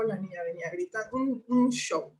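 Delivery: ordinary delivery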